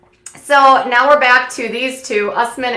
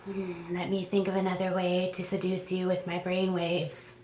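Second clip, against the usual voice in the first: low, soft mock-seductive murmur; a hint of a smooth talker; 3 semitones lower, 14 dB quieter than usual. Mocking Ash's seduction line, low and soft. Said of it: "And he's just all like".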